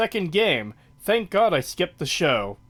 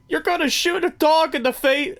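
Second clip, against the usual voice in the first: high squeaky mock villain voice, pitched way up; gleeful movie villain lines, much higher; imitating Jack Horner; 13 semitones higher than usual. Quoting the movie villain to a co-host, high mock voice. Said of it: "every moment with him is golden".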